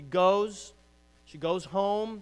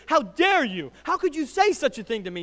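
high, loud mock outrage; indignant snap; 12 semitones higher, 16 dB louder than usual. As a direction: snap back as if gravely insulted, quickening. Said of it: "rather than respond in anger, rather than snap".

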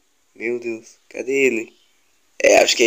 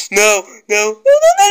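panicked no; 8 semitones higher, 4 dB louder, much faster than usual. Real, not acted. Caught again in game.

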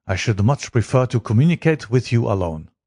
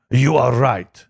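a touch of an anguished cry, slightly raised; mild cry; 4 semitones higher, 3 dB louder, slower than usual. Acted. King admitting his identity to Holmes, mild understated cry. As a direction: blurt it out, only mildly raised. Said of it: "he cried".

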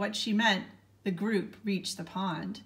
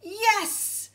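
high, loud eager shout; kid's excited shouted yes; 13 semitones higher, 5 dB louder than usual. A narrator's reading. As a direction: call it out with raised hand. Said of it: "Joy shouted".

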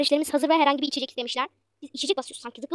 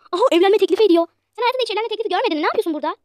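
mocking customer shout; 6 semitones higher, 10 dB louder than usual. Customer mocks proposal, shouting.

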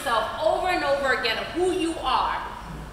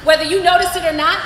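usual speaking voice

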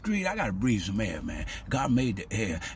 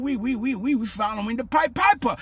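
ordinary talk, nothing marked

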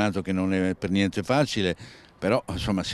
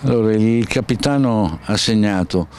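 loud, cheerful congratulation; excited; 7 dB louder than usual. Real, not acted. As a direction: hail the scorer with energy. Cheering the captain's goal.

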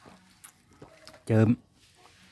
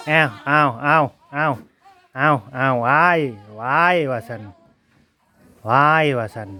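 put-on baby squeals; 4 dB louder than usual. Imitating the baby's squeals.

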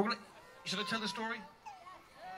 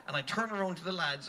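normal talking voice

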